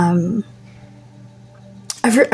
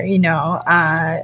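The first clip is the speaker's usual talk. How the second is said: ordinary talk, nothing marked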